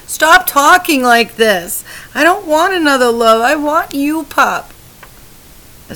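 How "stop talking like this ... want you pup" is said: higher and louder, fast, near breaking; anguished pleading. A narrator's reading. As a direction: beg him to stop, voice rising in anguish. Wife pleads with dying husband.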